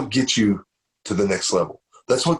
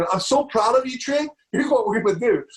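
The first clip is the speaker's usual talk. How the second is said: much higher, bubbling with pride; proud, excited praise; 9 semitones higher than usual. Retold or imitated. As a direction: gush over your son, high and bright with pride. Proud dad praising son.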